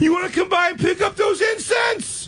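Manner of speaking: very high, shouted, lovesick whine; hammed-up squeaky plea. Begging the ex not to listen to his friend, very high shout. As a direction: shriek like a desperate teen. Acted bit continues.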